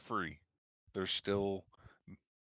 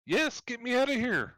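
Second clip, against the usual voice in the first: desperate plea; 8 dB louder than usual. Acted. Trapped NPC begs release.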